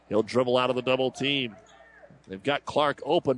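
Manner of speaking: usual speaking voice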